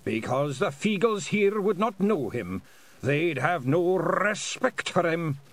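"respect" with a long, thunderous rolled R; high, deliberate voice, rolling the R of "respect" like an avalanche; avalanche of R's. A narrator's reading. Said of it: "made respect sound like an avalanche".

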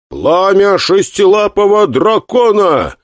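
high, slow thundering proclamation; grand entrance announcement. Acted. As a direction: boom it out like a battle spell. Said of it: "громогласно объявил я". (громогласное объявление)

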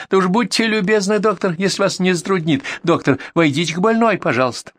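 high-pitched, syrupy sarcastic courtesy; mocking politeness. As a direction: plead with exaggerated, sneering politeness. Neighbour keeps mocking with false politeness.

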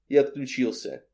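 usual speaking voice

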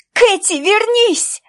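high slow shriek after her daughter. A narrator's reading. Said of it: "завизжала Аделина". (мать визжит вслед убегающей дочери)

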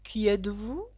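understated drowsy, dragging question; sleepy drawl. A narrator's reading. Caterpillar first addressing Alice, mildly sleepy and drawling. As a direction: ask it a little sleepily, trailing along. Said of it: "d'une voix endormie et traînante".

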